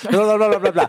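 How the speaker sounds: high, mocking blah-blah. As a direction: rattle it off in a mocking singsong. Mocking endless talk.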